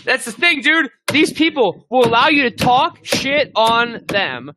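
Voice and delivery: high-pitched, drawn-out joke threat; hammed-up high voice, daring them to fight; mock fighting challenge. Joking that he will fight his critics, high mock-tough voice.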